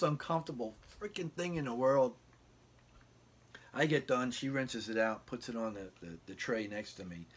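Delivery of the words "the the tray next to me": ordinary talk, nothing marked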